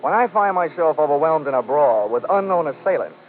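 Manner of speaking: dry, matter-of-fact report read; expense report narration. A narrator's reading. Detective reading his expense account to the home office. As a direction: list items like an official memo.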